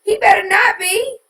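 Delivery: high, loud disbelieving outcry; shocked outcry. Real, not acted. Reacting to Sweet Mickey in drag, a high loud shocked outcry. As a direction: shriek in outrage at the news.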